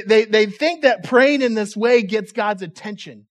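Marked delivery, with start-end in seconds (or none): none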